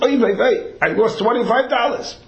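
high, wailing Yiddish lament; yiddish accent. Retold or imitated. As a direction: moan it like a distraught seller. Seller laments his loss.

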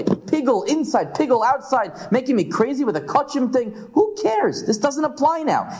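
high, fed-up complaint with a shrill peak on who cares; a student sick of impractical laws; exasperated Rava. Acted. Teacher acting Rava's complaint, high and exasperated. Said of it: "Rava asks".

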